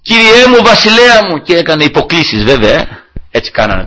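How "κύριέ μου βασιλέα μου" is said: calling out. Retold or imitated. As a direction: call out to the king from a distance. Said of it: "και άρχισε να φωνάζει".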